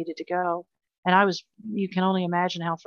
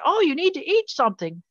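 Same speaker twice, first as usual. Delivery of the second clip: mocking well-meaning people; 9 semitones higher, 3 dB louder than usual. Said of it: "people were saying".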